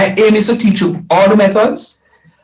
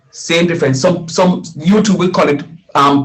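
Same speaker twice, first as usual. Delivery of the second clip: normal talking voice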